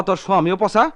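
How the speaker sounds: loud angry taunting demand. Acted. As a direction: push her to say it. Husband demands angrily.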